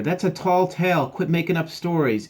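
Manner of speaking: high, loud brush-off; dismissive captain. Acted. Captain doubting the dog's sighting, loud dismissal.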